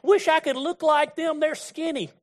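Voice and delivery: a high, whiny mock voice; mocking whiner. Preacher mocking people who envy skinny folks, high mock whine.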